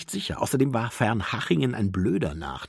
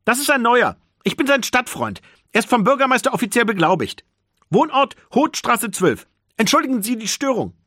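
higher, louder, fast and official; 10 semitones higher, 7 dB louder than usual. A narrator's reading. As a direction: announce it boldly to the teacher. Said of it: "mit lauter Stimme an den Lehrer".

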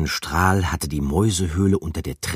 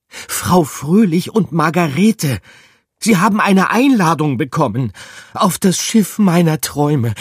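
voice cracking with excitement; 12 semitones higher, 9 dB louder than usual. A narrator's reading. Rat announcing the dream-ship invitation, voice cracking. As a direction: let the voice crack with joy. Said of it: "Gruyères Stimme überschlug sich".

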